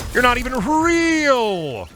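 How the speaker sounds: high, slow, stretched-out jeer at the enemy; shrill mock taunt. Mocking a bot opponent in a game, high and slow. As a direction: drag out real way up high like a playground taunt.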